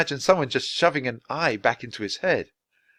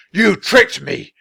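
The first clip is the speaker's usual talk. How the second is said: much higher, louder slow roar; furious yell; 9 semitones higher, 9 dB louder, slower than usual. A narrator's reading. Wizard screaming the accusation louder. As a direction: escalate into a full shout.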